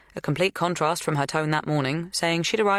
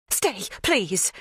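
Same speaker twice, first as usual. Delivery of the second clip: urging; 5 semitones higher than usual. A narrator's reading. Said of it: "she urges".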